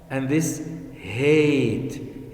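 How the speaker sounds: hate said very slowly with long ay. Shown as a demonstration. Teacher contrasting hat and hate, slowly. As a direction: drawl hate out. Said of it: "This is hat".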